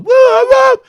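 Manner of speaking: high loud woo-woo siren sound; mimicking police sirens in the story; siren imitation.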